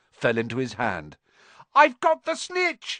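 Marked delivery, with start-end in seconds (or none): 1.8-3.0 high, slow, triumphant shout. Acted. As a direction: yell out the victory. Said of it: "he shouted".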